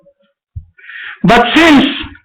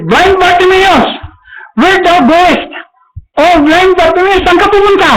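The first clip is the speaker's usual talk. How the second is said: calling high priest; 8 semitones higher than usual. Acted. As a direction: call down from the temple wall. High priest calls from the wall.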